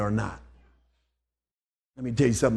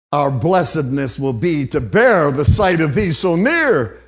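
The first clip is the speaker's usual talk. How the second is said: poem read as rising sermon, louder; preached poem; 2 semitones higher, 6 dB louder than usual. Acted. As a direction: build the verses to full preaching force. Reads poem at preaching force.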